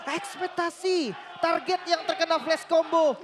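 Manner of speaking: high, loud, slow hype; hyped caster call. Real, not acted. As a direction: sell the big moment. Caster hypes triple kill.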